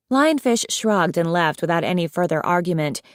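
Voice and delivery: plain reading voice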